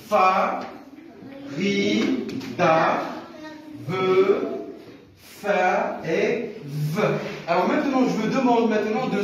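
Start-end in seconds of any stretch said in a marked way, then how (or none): 0.1-7.0 isolated syllables with long pauses between them. Shown as a demonstration. Teacher rereads the syllables to the class, syllable by syllable. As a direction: slowly sound out each syllable separately. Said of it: "je vais vous relire encore une fois ces syllabes-là".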